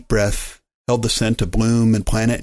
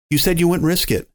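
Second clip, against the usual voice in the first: higher, tense accusing voice; loud and shaky; 8 semitones higher than usual. A narrator's reading. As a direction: challenge her, still rattled. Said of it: "his voice still loud and shaky".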